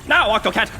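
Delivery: ordinary talk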